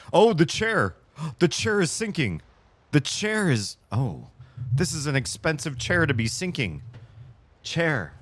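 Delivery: high startled gasps; alarmed and high, caught off guard. Real, not acted. Chair suddenly sinking under her.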